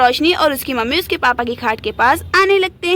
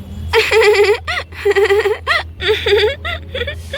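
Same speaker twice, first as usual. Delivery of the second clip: long giggling fit; mocking laughter; 5 semitones higher than usual. Mother and daughter laughing at father's hair, mocking giggles. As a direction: laugh on and on at someone. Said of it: "उसके पापा के ऊपर हंसने लगते हैं".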